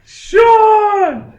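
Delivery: long, high, very loud yell; over-the-top mock scream; screamed name.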